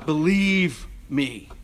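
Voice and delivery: drawn-out, pleading believe me; slow it right down and plead; slow plea. Real, not acted. Slow emphatic plea to congregation.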